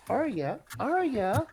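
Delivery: insistent high call; calling for attention. Acted. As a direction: call a distracted friend, rising on the repeat. Calling a distracted companion's attention.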